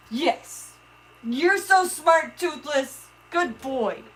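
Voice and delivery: high, slow, soft praise for a pet. Real, not acted. Owner cooing praise at her pet fish, tender. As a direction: coo at the fish.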